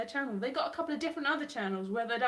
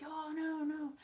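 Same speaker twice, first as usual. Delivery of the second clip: mild mock fear; 5 semitones higher, slower than usual. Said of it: "I'm scared of heights".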